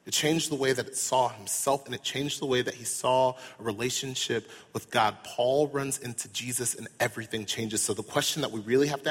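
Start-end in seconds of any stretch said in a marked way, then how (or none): none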